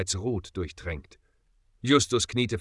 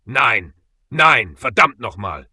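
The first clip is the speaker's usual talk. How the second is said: louder, a cry of grief torn out over a dead friend; anguished outcry; 10 dB louder, slower than usual. Acted. Justus over his dead friend Bob: a loud, anguished cry. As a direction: yell it at the sky in raw despair. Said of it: "Seine Stimme brach".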